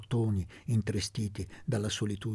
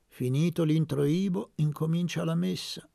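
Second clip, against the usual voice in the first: sly; 6 semitones higher, 4 dB louder than usual. A narrator's reading. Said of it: "disse sornione Elpidio".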